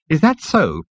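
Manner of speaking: tart. Acted. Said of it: "the insect said tartly".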